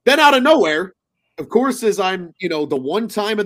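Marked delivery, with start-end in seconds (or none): none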